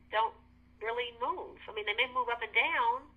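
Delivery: ordinary talk, nothing marked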